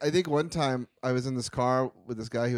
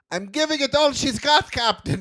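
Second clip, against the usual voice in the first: Scotty voice; 12 semitones higher, 6 dB louder than usual. Retold or imitated. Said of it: "he goes".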